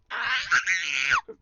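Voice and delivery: real outraged yell, loud and sudden; a loud burst of shock. Real, not acted. Gamer reacting to the chat, shocked yell.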